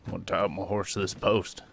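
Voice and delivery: lightly voiced game AI quip; mimicking the voice pack. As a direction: quote the ship AI's random line, slightly played. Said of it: "random things that he says".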